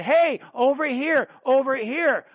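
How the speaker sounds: loud, slow call across the room. Real, not acted. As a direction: call someone over from afar. Calling someone over.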